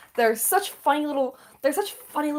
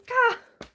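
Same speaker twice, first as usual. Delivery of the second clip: high startled yelp; 11 semitones higher than usual. Real, not acted. Sudden outburst.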